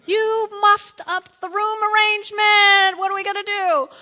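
exaggerated loud complaint, stretched out; mock outrage. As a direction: holler in comic dismay. Acting dismay at surprise baby girl.

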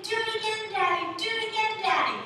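put-on high, excited child voice; eager begging child. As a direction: plead like kids who want another turn. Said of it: "then they would say".

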